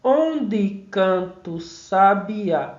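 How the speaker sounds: "Onde canta o sabiá" slowly split into syllables; slow count-out with "canta o" merged as "can-tu". Shown as a demonstration. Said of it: "agora vamos ver sua separação silábica".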